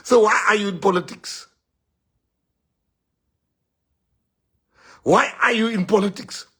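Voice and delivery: high, loud rhetorical question; challenging the crowd. Real, not acted. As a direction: throw the question out forcefully.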